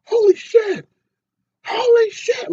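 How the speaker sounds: very high, loud panicked shriek; mock freak out. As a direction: scream it like you just saw someone swallowed by the ground. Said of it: "this freak out where he's like".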